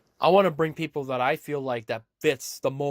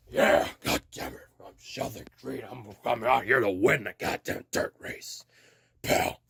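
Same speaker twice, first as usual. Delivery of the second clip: low, rough growled impression; Sheldon Creed; 6 semitones lower than usual. Driver vowing to win the dirt race, growled mock boast. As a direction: growl it like a hammed-up wrestling promo. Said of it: "this is Sheldon Creed being like".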